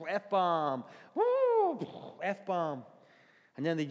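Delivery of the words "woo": high, loud whoop standing in for a curse; hammed-up censor whoop; mock bleep whoop. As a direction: bleep out the swear word with a yelp.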